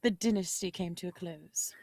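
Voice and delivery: put-on hushed, low British accent; soft, whispery British delivery. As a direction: whisper it like a British prologue narrator. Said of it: "that's a good accent".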